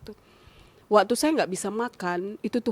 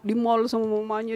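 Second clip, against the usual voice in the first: ordinary talk, nothing marked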